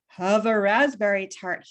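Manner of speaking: the fox tempting Winnie, low; sly Mister Johnson. Acted. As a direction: offer it low and sweet. Fox voiced in read story.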